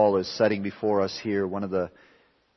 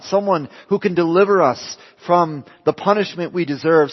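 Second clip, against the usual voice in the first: rising, louder pulpit voice; fervent preaching; 6 semitones higher, 7 dB louder than usual. Acted. Preacher pressing the congregation with rising questions, fervent. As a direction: build the questions with growing fervor.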